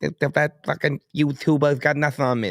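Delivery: halting, high mock boxer voice; Mike Tyson. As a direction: imitate a lisping boxer talking trash before a fight. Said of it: "That was my Mike Tyson".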